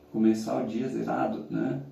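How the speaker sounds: ordinary delivery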